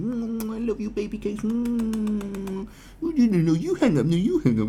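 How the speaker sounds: mock lovesick phone call; mushy lover. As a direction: coo like a smitten boyfriend. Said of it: "And mush".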